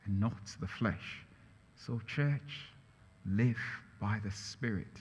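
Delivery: quiet pulpit refrain; soft closing exhortation. Acted. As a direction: gently urge the church.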